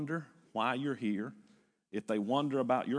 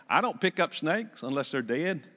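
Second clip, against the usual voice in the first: usual speaking voice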